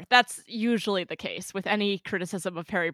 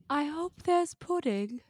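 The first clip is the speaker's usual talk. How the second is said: dreamy Luna, pitched high and slow; better Luna impression; 9 semitones higher, slower than usual. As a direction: float it out airy and faraway. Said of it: "do your better Luna impression".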